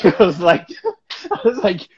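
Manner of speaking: cracking up mid-story; laughing. Real, not acted. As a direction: laugh through the words. Laughing at Jackie's teasing.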